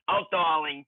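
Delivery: ordinary talk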